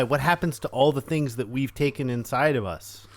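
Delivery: usual speaking voice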